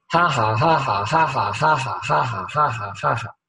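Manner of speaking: slow rhythmic ha-ha, two-beat pulses; imitated pastor's tongues. Preacher imitates a well-known pastor's tongues, rhythmic ha-ha. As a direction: chant ha-ha steadily, imitating a pastor. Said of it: "一个很有名的牧师".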